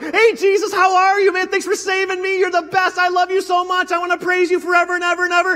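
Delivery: high, loud, gushing joy at meeting Jesus; excited greeting. Pretend. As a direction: squeal it like a thrilled fan. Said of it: "I'm like".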